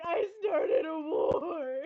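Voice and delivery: slow, wailing through mock tears; crying. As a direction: sob it out, slowly and hammed-up. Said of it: "and she's crying again".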